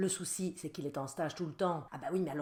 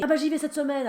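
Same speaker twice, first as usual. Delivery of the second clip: put-on much higher and louder voice of a careless teen; mock teen son; 9 semitones higher, 9 dB louder than usual. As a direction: toss it off like a cocky teenager. Son quoted in retold argument.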